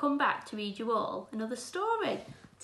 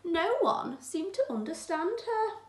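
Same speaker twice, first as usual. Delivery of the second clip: put-on gentle, clear read-aloud for children; teacher reading a picture book aloud; storybook reading; 8 semitones higher than usual. A narrator's reading. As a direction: read it like a bedtime story to the class.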